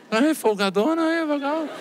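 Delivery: high, soft sing-song teasing; mocking. Son teasing mother about resting while intubated, mocking. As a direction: tease in a put-on high little voice.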